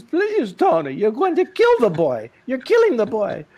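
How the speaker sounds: high, loud frantic plea; pleading wife. Retold or imitated. Wife begging Tony to stop attacking the boy, high frantic plea. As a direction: beg him to stop hurting the boy.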